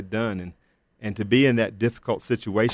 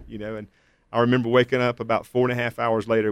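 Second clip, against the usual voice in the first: normal talking voice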